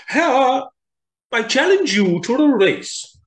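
high, loud call across; loud challenge. Acted. Tortoise challenging Hare, loud call. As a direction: throw down the challenge.

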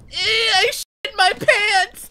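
high, slow, whiny wail; Yee impression. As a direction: stretch it out in a crying squeal. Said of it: "you wanna hear my Yee impression".

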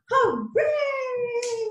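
hooray leaps high; cheering hooray. Shown as a demonstration. Song shout hooray.

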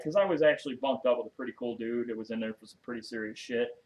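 ordinary talk

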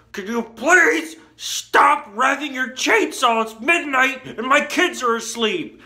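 high, loud mock scream, stretched key words; imitated screaming. Neighbor at midnight with sleeping kids, imitated screaming. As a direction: scream it like a fed-up neighbor, hammed up. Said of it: "really screaming at me".

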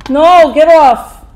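loud protest. Real, not acted. Pushing off attacker in game.

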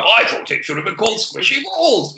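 high, hammed-up mimicry; mocking catchphrase imitation. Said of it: "you play it out".

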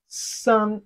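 "San" on a high, level pitch; flat tone. Shown as a demonstration. Teacher models Chinese three for learners, flat-tone "San". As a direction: hold "San" steady with no fall. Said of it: "which is the flat tone".

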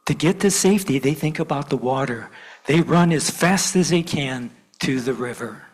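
put-on lively read-aloud for kids at church; storytelling to children. A narrator's reading. Minister reads to gathered children, animated storytelling. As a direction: tell it like a picture book.

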